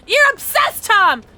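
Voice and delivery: high, loud, drawn-out accusation; hammed-up high-pitched scolding; mock-scolding shout. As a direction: shout it in playful outrage, stretching the words. Mock-scolding Tom in chat.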